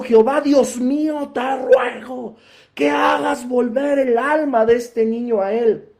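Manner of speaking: grito de súplica, agudo y sostenido; clamor exuberante. Acted. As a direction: ruega a Dios alzando la voz, con las palabras alargadas. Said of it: "la voz de Elías se levantaba de una forma así exuberante". (clamor a voz en cuello)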